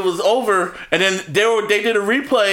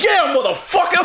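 much higher mock triumphant shout; hammed-up coach celebrating; imitating Kyle; 11 semitones higher than usual. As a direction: play Shanahan gloating at the final kill. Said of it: "Kyle Shanahan was like".

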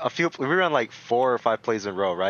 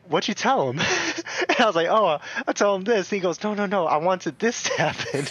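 annoyed coach; 6 semitones higher than usual. Retold or imitated.

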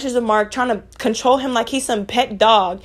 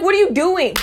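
a hint of a nagging father, pitched higher; nosy dad; 7 semitones higher than usual. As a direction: mimic the dad questioning them, a little higher. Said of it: "His dad comes outside ... like".

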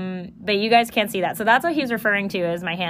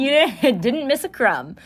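laughing; 5 semitones higher than usual. Real, not acted. Teasing friend, laughing.

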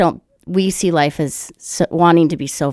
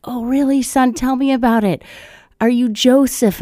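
higher-pitched mock mom questioning her son; voiced mother; 7 semitones higher than usual. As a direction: play the curious mom, pitched up.

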